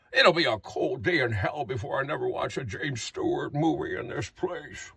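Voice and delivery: put-on James Stewart-like old man. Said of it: "Do you not hear the resemblance in your voice".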